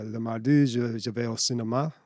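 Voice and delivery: haltingly spoken French sentence; speaking French. Shown as a demonstration. Asked to translate, he tries it in French. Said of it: "can you say".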